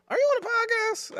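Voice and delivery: very high-pitched curious question; imitated school principal. Imitating the principal once more.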